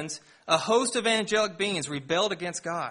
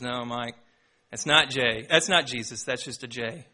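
Bu Chen; 7 semitones lower than usual. Retold or imitated. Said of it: "which I won't try to imitate".

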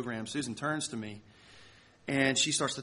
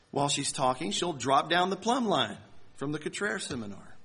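usual speaking voice

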